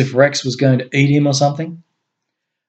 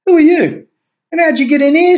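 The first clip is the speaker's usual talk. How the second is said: much higher, loud suspicious bark; rough challenge; 5 dB louder than usual. A narrator's reading. Guard confronting the stranger at the gate, rough demand. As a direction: demand answers from an intruder.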